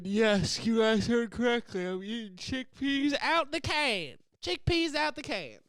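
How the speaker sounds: high, loud, slow showy confession; mock proud announcement. Vlogger gleefully admitting to viewers he eats chickpeas from the can. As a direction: ham it up like a proud reveal.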